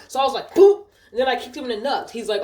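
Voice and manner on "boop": playful boop. Retold or imitated. Mock kick sound.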